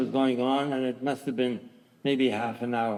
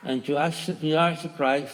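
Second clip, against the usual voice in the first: ordinary talk, nothing marked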